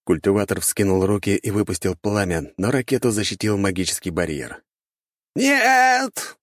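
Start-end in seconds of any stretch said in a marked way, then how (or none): 5.3-6.4 high, loud cry of protest; shouted cry. A narrator's reading. Cultivator as the rocket hits him, high shouted cry. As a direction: yell it as the blow lands. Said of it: "выкрикнул культиватор".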